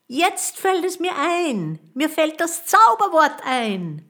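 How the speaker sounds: high, loud triumphant cry; exult, voice leaping up; jubilant. A narrator's reading. Boy suddenly remembers the magic word, jubilant. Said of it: "jubilierte Peter".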